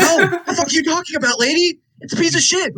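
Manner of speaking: high, fast, scoffing rant; hammed-up outrage at the clerk; mock indignant yell. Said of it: "And then they're like".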